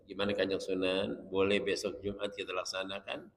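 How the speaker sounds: student softly asking Sunan Ampel about Friday prayer; gentle polite request. Shown as a demonstration. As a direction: speak quietly to the revered saint.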